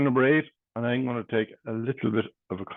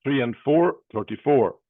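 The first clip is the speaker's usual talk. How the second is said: bingo caller. Acted. Calls bingo numbers.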